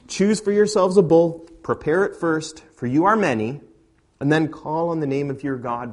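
a prophet's bold challenge; preached challenge. Acted. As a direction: call out the dare boldly. Elijah to Baal's prophets.

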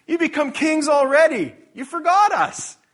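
loud, high, cutting sarcastic jab; sharp sarcasm. Acted. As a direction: jeer it up high and loud like a stung apostle. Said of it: "He's being really, really sharp and sarcastic".

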